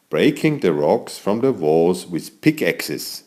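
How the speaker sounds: ordinary delivery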